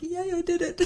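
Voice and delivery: high, delighted, laughing cheer; childlike glee. Retold or imitated. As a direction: cheer like a happy kid. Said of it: "I was like".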